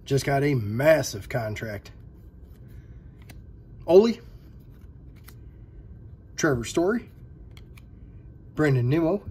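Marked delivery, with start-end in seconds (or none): 3.9-4.2 excited cheer. Real, not acted. Cheering a card pull.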